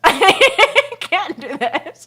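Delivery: slow, stretched through laughter; laughing. Real, not acted. On the phone with dad, laughing helplessly. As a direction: overcome with giggles.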